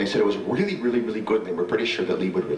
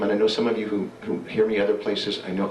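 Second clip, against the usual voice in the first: ordinary delivery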